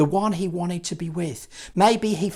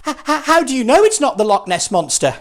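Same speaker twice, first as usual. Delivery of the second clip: higher, louder, fast stuttering challenge; stammering fright; 8 semitones higher, 7 dB louder, faster than usual. Acted. Scared disciple stammers back at his friend, nervous. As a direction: trip over the first word, panicky.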